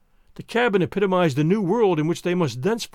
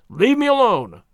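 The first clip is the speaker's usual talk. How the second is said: loud, higher hoarse outburst; hoarse cry; 5 semitones higher, 5 dB louder than usual. Acted. Husband yanks the gun away from wife, crying out hoarsely. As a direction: shout it rough from the throat. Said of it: "he cried hoarsely".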